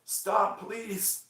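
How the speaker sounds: high, wincing imitation; pained plea. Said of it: "she'd just be like".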